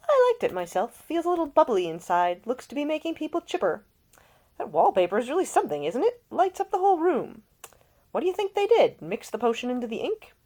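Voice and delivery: high, loud bubbly chatter; chipper young gent. Acted. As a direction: gush about the glowing wallpaper. Younger son chatting at party.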